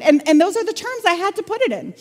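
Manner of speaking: ordinary talk, nothing marked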